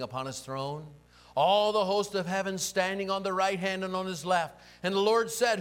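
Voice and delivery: loud, ringing prophetic proclamation; thundering scripture reading. Acted. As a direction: declaim the heavenly vision at full force. Reads the vision at full force.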